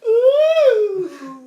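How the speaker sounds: high, loud drawn-out teasing whoop; audience "ooh". Retold or imitated. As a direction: imitate a sitcom crowd at a kiss. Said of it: "they didn't go".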